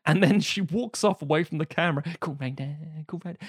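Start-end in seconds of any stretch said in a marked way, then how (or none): 2.2-3.4 soft sung refrain, receding; fading into the distance. Retold or imitated. Singer walks off away from the camera, sung fading away. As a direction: sing it quietly, as if walking away. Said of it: "the song's getting quieter as if it's following her".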